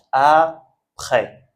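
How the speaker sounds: "après" slowly in two parts, a-près, no S; silent final S. Shown as a demonstration. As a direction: stretch "après" and stop on the open "è". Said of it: "on ne prononce pas". (après découpé, sans S final)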